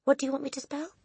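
put-on high child's voice; reply in a high, boyish voice; Eric. A narrator's reading. Boy standing up to the headmistress, high voice.

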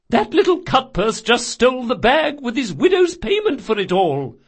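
high, loud scolding; furious old woman. Acted. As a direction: berate the sergeant for his stupidity.

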